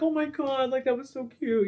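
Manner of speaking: high, soft cooing over something cute; melting fan squeal, kept small; gushing. Acted. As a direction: coo it up high, a laugh creeping in. Imagined gushing viewer, high soft voice.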